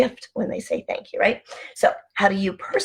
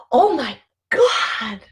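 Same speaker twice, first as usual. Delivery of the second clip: gasp, then a high, slow, amazed "Oh my God,"; breathless, amazed "Oh my God,"; awed oh my God; 4 semitones higher, 4 dB louder, much slower than usual. Shown as a demonstration. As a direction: let "Oh my God," break with wonder after a gasp. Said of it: "the difference between".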